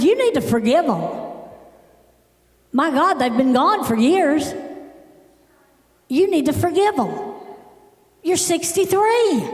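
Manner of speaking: high-pitched retold telling-off; exasperated scolding.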